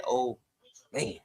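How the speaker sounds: ordinary talk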